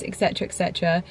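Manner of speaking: ordinary talk, nothing marked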